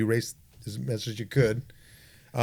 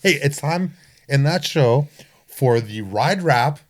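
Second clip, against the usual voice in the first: slow, louder announcer voice introducing the segment; 3 semitones higher, 6 dB louder, slower than usual. Acted. Host to guest, announcing the crash segment, slow loud showman voice. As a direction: draw out the segment title like a show host.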